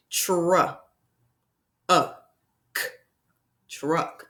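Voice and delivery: segmented slowly into sounds; sound-by-sound segmenting; sounds tapped out. Shown as a demonstration. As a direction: tap out each sound, tr, uh, k, then blend. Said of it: "let's listen to the sounds".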